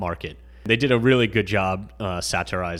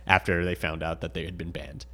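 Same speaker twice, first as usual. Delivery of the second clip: ordinary talk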